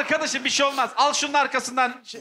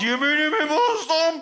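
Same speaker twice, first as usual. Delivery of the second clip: words pushed through clenched teeth; slow, strained through gritted teeth; 2 semitones higher, slower than usual. Shown as a demonstration. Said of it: "dişleri sıkarak".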